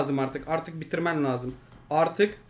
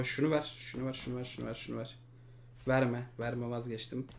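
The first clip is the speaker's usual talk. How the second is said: muttering; 8 semitones lower, 14 dB quieter than usual. Real, not acted. Muttering to himself.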